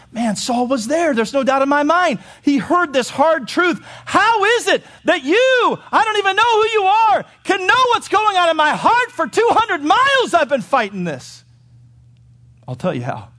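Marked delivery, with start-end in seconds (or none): 0.1-3.8 high-pitched, building preacher's delivery; urgent pulpit build; impassioned preaching. Acted. Preaches at full force. 4.0-11.4 very high, loud, strained shout; acted anguished yelling, easing at the end; incredulous outburst. Preacher acts out Saul's outburst.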